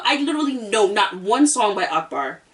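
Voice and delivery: ordinary talk, nothing marked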